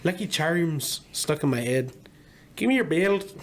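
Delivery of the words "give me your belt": higher mock Irish brogue; cartoon Irish accent; exaggerated Irish accent. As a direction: slip back into a silly Irish voice. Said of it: "of course they're exaggerated".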